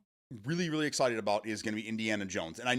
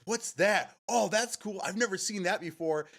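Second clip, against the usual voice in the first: high-pitched imitation of an amazed kid; mock kid's wonder, voice lifted; excited kid; 8 semitones higher than usual. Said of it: "this one kid just comes to me".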